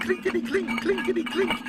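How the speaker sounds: high, staccato rattling of coins; coins clinking. A narrator's reading. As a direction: imitate coins jingling in a tin. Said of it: "and the money went".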